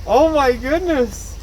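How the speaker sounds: stunned delight pouring out; overwhelmed oh my goodness. Real, not acted. Overwhelmed by the gift reveal.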